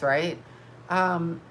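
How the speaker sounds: usual speaking voice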